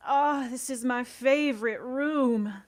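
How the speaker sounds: high, slow, put-on contented voice; senator voice. Senator in the basement, slow and pleased. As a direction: savour each word like a pleased old senator. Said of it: "he's just going".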